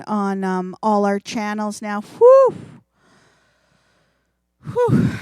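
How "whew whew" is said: big nervous whew, high and loud; nervous exhale. Real, not acted. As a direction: blow out the nerves. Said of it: "take a second to calm down myself".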